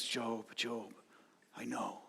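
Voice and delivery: an imagined God soothing Job, soft and tender; sympathetic tone. Pretend. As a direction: comfort him tenderly, almost under the breath. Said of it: "with this sympathetic tone".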